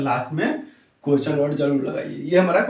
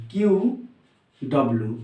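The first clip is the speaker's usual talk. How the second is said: each item separate, with a pause; 4 semitones lower than usual. Shown as a demonstration. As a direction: dictate "Q ... W" as if writing. Dictates while writing it out. (लिखते हुए, अलग-अलग बोलना)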